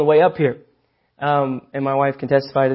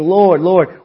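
higher and louder double Lord; emphatic address of honor; emphasized Lord, Lord; 4 semitones higher, 7 dB louder than usual. Retold or imitated. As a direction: stress both words like a proclamation. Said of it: "they would emphasize it".

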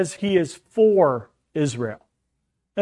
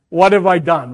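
usual speaking voice

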